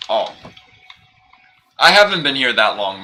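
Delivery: ordinary delivery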